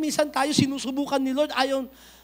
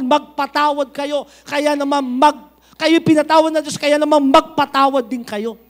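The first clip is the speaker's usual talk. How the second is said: forceful preaching; 8 dB louder than usual. Acted. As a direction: proclaim it to the whole church.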